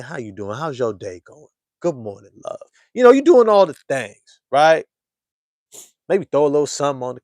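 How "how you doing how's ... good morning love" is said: much softer, tender check-in; gentle lover's good morning; soft sweet talk. Retold or imitated. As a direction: murmur it like a caring text. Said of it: "sweet texts quoted".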